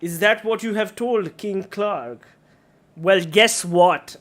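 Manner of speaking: loud mocking monster voice; bugbear taunt. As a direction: sneer it at the players, loud. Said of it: "he says".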